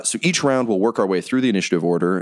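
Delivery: usual speaking voice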